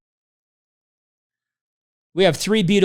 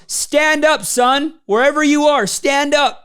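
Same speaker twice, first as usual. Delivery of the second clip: raised, high call to the crowd; a co-founder beckoning from the stage, pitched up; 8 semitones higher, slower than usual. Retold or imitated.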